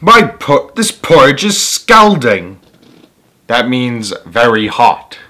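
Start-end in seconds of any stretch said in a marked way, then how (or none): 0.0-2.6 raised, louder cry of a scalded bear; big Papa Bear crying out. Acted. Papa Bear at breakfast, crying out loudly. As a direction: complain loudly like a burnt father bear. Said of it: "cried big old Papa Bear".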